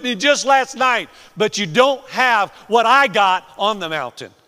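loud, high-pitched scolding; exasperated rebuke. Acted. Jesus, voiced by preacher, scolding his disciples, exasperated. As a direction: shout the rebuke at your own followers. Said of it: "He's still a little irritated".

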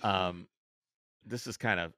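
ordinary talk

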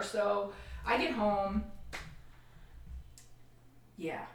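ordinary talk

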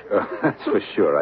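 laughing the words out slowly. Acted. Husband answering his wife, laughing through words. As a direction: chuckle through the reply.

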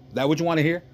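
mock challenge with a high lift; unapologetic. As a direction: imitate a remorseless father needling his son. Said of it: "he's so unapologetic about his actions".

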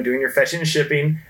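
ordinary delivery

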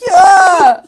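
mock mid-cry wail; hammed-up wailing yes. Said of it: "And the guy's like".

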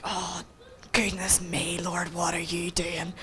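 low whispered aside, slow; hushed disbelief. Retold or imitated. Stunned woman praying under her breath, hushed disbelief.

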